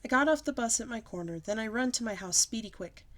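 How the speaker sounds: usual speaking voice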